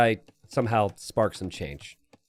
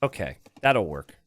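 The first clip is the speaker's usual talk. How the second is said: ordinary talk, nothing marked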